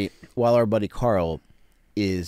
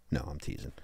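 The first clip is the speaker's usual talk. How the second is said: ordinary talk, nothing marked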